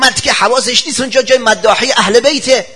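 high, loud, fast protest; high loud indignant outburst; indignant retort. Retold or imitated. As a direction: protest loudly against the praise. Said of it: "گفت".

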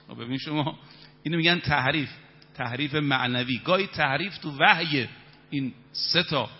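ordinary talk

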